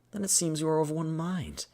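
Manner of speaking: theatrical agreement; game character's reply. Acted. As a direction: pitch it up as a formal character line. Acting out game dialogue.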